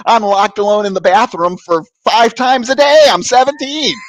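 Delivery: high, loud comic outburst; exasperated teenage protest. Retold or imitated. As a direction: plead the teenager's case, hammed-up.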